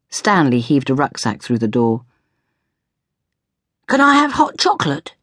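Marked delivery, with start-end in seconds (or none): 3.9-5.1 put-on high-pitched pleading boy; small, high child voice; child's voice. A narrator's reading. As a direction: ask like a kid wanting a treat. Child Stanley asks for a drink.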